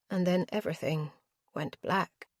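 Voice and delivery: ordinary delivery, nothing marked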